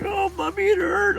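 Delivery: put-on very high-pitched hurt kid voice; mock pained boy's squeal; whining little brother. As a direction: whine like a small child in pain.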